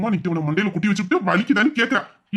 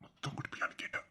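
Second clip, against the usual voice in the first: very soft, voiceless whisper; intimate whisper; 23 dB quieter than usual. Acted. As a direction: whisper the request for a kiss. Husband privately asking for a kiss. (கொஞ்சலான கிசுகிசுப்பு)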